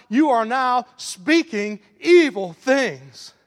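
loud, slow, hammered-out preaching; preacher pounding the line at full force; preaching build. Real, not acted. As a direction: drive each word home slowly. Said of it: "preaching at full force".

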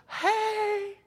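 very high, long-held smiling hello; hammed-up beaming greeting; Joel Osteen. Preacher mimicking a big-smiled televangelist, high and cheery. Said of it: "Got the big smile of Joel".

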